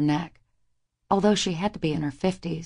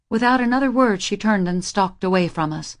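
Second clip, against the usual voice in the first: plain reading voice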